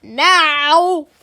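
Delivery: loud, furious scream; enraged teacher's bellowed order. A narrator's reading. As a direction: scream the command. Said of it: "screamed Mister Nurden".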